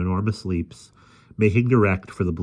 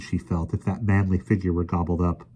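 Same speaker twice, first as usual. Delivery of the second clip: plain reading voice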